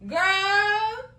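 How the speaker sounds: high rising exclamation. Real, not acted. Exclamation at the scene.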